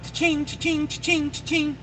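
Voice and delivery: high, soft cha-ching sound effect; mock cash-register chime; imitated cash register. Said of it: "she just sees dollar signs".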